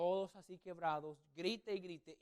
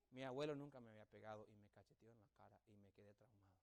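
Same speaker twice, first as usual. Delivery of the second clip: ordinary talk, nothing marked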